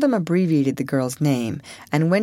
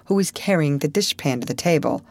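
usual speaking voice

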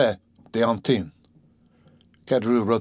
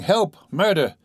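high desperate cry; calling for help; 7 semitones higher, slower than usual. A narrator's reading. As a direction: yell it out while falling.

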